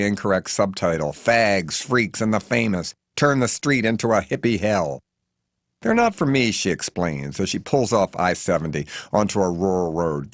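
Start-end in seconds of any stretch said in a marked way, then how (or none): 5.8-6.5 brisk, high, bright denial of the books; Bernice's voice. Acted. Bernice to the narrator, pitched up and lightly dismissive. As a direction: toss it off lightly, a little higher and louder.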